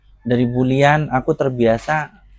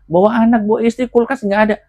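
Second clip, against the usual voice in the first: high, raised-voice telling-off; mama's scolding; 9 semitones higher, 4 dB louder, faster than usual. Retold or imitated. Mama scolding her grown child over the empty fridge, high-pitched telling-off. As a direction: imitate a mother nagging, pitched well up. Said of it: "Kalau diomelin nggak usah".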